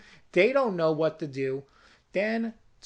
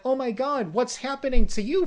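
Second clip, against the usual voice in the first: shocked; 5 semitones higher, 6 dB louder, faster than usual. Retold or imitated. Shocked discovery of tied girl.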